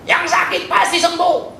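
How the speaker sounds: high, slow mock preacher promise. Pastor mocking prosperity preachers, high and slow. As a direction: parody a healing preacher. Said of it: "jangan harap dengar khotbah di tempat ini".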